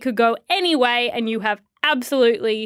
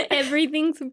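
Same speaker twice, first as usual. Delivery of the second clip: ordinary talk, nothing marked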